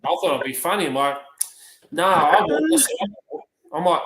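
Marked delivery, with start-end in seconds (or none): 1.9-2.8 high-pitched, louder joking refusal; mock refusal. Carer joking back to resident, high mock refusal. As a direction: refuse in a raised, playful voice.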